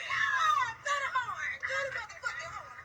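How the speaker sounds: cheering loudly; excited shout. Real, not acted. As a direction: yell it out like a hype man. Hyping a celebration, shouting.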